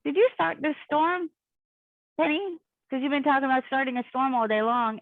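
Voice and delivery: a hint of a suspicious cop; mock police questioning.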